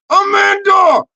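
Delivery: high, loud name holler; sudden shouted greeting; calling out. Pretend.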